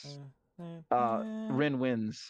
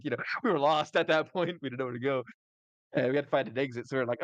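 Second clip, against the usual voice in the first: usual speaking voice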